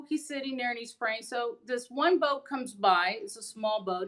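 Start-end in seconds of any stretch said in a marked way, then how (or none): none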